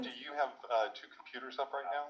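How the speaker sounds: faint distant echo; audio echo. Real, not acted. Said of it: "Can you hear the echo?".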